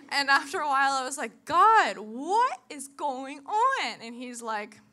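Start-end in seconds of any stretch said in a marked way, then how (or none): 1.4-4.0 loud, drawn-out plea; exasperated cry to God. Retold or imitated. Crying out to God in frustration, loud. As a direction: throw the question at the sky.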